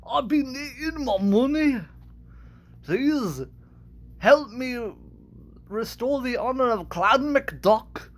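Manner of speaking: high-pitched cartoon old-uncle duck with shaky Scottish accent; high cartoon voice, Scottish slipping into British; Scrooge McDuck. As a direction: scold the nephews in a rough attempt at Scottish. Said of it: "a little bit of Scrooge McDuck".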